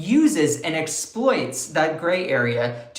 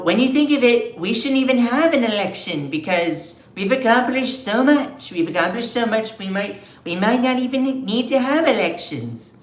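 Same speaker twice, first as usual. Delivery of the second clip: higher, louder put-on Trump voice; boastful Trump imitation; mock Trump; 6 semitones higher, 4 dB louder than usual. Vlogger quoting Trump on canceling elections, hammed-up Trump voice. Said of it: "Trump at one point literally said".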